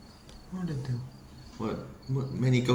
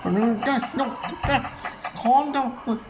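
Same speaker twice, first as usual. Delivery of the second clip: panicked pleading; 10 semitones higher, slower than usual. Acted. Begging the guys to calm down, panicked.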